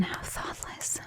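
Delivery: barely voiced whisper, chiding herself; whispered self-reproach. Vampire chides herself to her lover, whispered. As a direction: breathe it out as a hushed aside to yourself.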